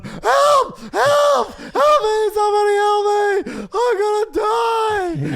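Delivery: mock panicked high scream, stretched at the end; hammed-up wailing cry for rescue, loud and high; crying for help. Friend mimicking Kevin Lee pleading in the rapids, panicked wail. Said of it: "He was crying like a bitch".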